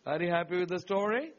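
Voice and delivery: usual speaking voice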